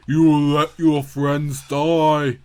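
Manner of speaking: hammed-up dark threat; menacing villain. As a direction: savour the threat. Enemies threaten the king.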